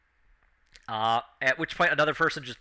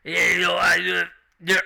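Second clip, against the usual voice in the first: louder slurred mumble; drunk orc; 3 semitones higher, 6 dB louder than usual. Acted. Orc about to topple over, loud slurred mumble. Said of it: "the orc just goes".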